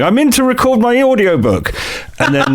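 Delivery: high, bright mock influencer; mock a chirpy Instagram post. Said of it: "a cup of tea going".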